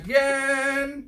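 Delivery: hammed-up slow intro; slow announcer drawl. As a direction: draw it out like a show host. Intro line drawn out.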